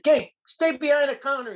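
sharp high yell. Retold or imitated. Restaurant man yelling at Chris to stay back. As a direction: yell the order at him. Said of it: "he yelled".

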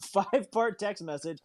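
ordinary talk, nothing marked

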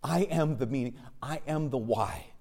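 hushed, measured solemnity; God's solemn declaration. Retold or imitated. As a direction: declare it quietly, one truth at a time. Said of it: "That God says".

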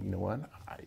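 whispered words to his father; soft whisper. Retold or imitated. To his father, saying he understands, in a whisper. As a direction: breathe it out, barely voiced.

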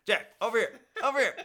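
high loud laughing call; calling from far away. Pretend. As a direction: shout across the field, laughing.

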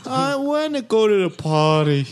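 high playful put-on voice; squeaky voice through laughter; high silly voice.

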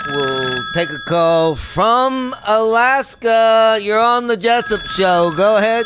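radio host announcing. Acted. Host throws to a caller from Alaska, big announcer call. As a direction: project it slowly, then punch the go-ahead.

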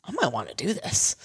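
soft, rising, tempted musing; tentative interest. Acted. Newcomer walking away from the experience, softly intrigued. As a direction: quietly open to it, voice lifting at the end. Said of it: "you should walk away being like".

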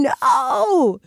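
a tight, high inner no; a thought-only no, held back; a silent protest said aloud, high; inner refusal. Retold or imitated. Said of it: "but I'm thinking".

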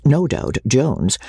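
ordinary delivery, nothing marked

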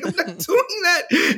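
high, slow, laughing refusal. Retold or imitated. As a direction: refuse outright, amused. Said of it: "I was like".

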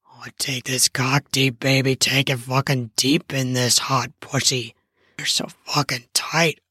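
low, rough-edged male voice; dominant man. Acted. Man in dom mode talking dirty to partner, low. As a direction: growl it low and possessive.

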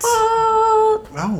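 high drawn-out oh; amazed oh. Real, not acted. Host reacting amazed to cohost's sex news. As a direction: gasp it out in awe.

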